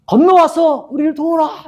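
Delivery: imitated high-pitched cry for help; calling out. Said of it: "이렇게 외치거든요". (높은 목소리로 외치며 요청하기)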